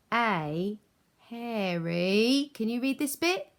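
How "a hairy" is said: very slow, louder rising hairy; drawn-out prompt. Shown as a demonstration. Teacher prompting kids, slow rising cue. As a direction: stretch it and wait for them. Leaves gap for children.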